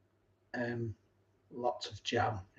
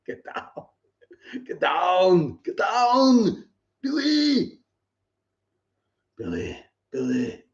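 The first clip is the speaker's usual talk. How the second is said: Arnold impression building up; 4 semitones higher, slower than usual. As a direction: build from a breath to a desperate Arnold scream. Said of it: "an Arnold impression".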